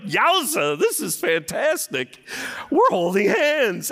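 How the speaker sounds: high, loud, slow thrilled shout; thrilled young man. Retold or imitated. Pastor reliving first holding his wife's hand, thrilled high shout. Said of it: "I'm like".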